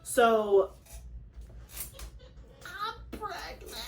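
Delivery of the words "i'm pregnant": higher, much softer, slow reveal; hushed reveal. Real, not acted. Woman revealing pregnancy to viewers, hushed and slow. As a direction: breathe out the news in awe.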